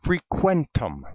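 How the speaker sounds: "frequentum" pronounced as a Latin word; careful Latin reading of "frequentum"; Latin pronunciation. Shown as a demonstration. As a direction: say "frequentum" in Latin, set apart as the source word. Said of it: "they took it from the Latin".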